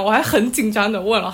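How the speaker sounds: usual speaking voice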